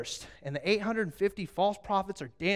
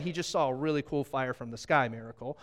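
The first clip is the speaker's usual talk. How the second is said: ordinary delivery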